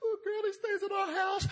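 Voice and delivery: high, hammed-up tearful whine; mock crying. Mary answers her sister through mock tears, high wailing. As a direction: blubber the answer in a high put-on voice. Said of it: "stop that crying".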